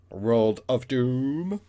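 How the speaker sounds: put-on slow, drawn-out Quebec-accented English title. Streamer reading the game title to chat, slow Quebec-accented English. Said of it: "Avec mon accent".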